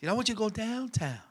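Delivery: very soft, hushed "downtown"; suggestive downtown. Shown as a demonstration. Woman asking lover to go "downtown", hushed and suggestive. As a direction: murmur "downtown" as a private innuendo. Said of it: "See how I said it, Charles? There's a difference".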